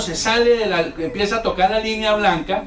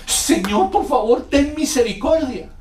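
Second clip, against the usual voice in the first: higher, louder begging prayer; desperate mock plea; 9 semitones higher, 5 dB louder, slower than usual. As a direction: beg the Lord as trouble boils over. Cuando las papas están hirviendo. (súplica desesperada imitada, más aguda y fuerte)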